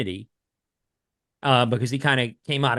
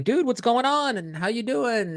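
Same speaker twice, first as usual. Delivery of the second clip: excited greeting; 10 semitones higher than usual. Retold or imitated. Greeting an old friend.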